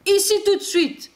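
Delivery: high, quick storybook order; commanding. A narrator's reading. Santa calling the playing reindeer back, commanding. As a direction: call them sharply to heel. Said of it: "ordonne-t-il aux rennes".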